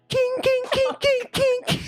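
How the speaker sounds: very high tinny clinking sound; doorknob clink noise. Acted. GM voices the doorknob clinking on the ground. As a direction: squeak out the clink of metal hitting the floor.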